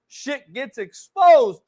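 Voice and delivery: normal talking voice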